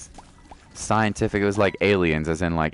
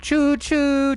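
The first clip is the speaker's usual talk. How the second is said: train whistle; 4 dB louder than usual. Retold or imitated. Imitating a train.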